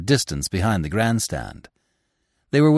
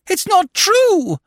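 high, slow, loud scream of denial; 5 dB louder, slower than usual. A narrator's reading. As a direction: scream it in disbelief. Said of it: "she screamed".

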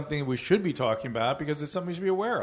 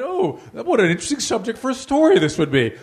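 higher, louder mock fascination; mock writer delight; 8 semitones higher, 9 dB louder than usual. Writer spying on motel guests, mock fascinated. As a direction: ham up a writer smelling a story. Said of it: "and went".

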